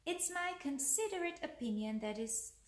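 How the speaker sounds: lively bedtime-tale narration; story read to children. A narrator's reading. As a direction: read a picture book aloud, pausing between lines.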